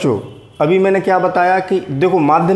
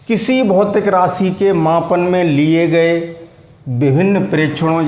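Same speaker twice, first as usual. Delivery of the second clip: slow, deliberate dictation pace; 2 semitones higher than usual. Shown as a demonstration. Teacher dictating a definition to students, slowly. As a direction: spell it out slowly so they can write. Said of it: "मैं धीरे-धीरे बोल रहा हूँ".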